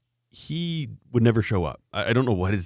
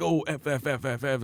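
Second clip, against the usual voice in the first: mock angry cursing; 6 semitones higher, 4 dB quieter than usual. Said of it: "If somebody's really angry".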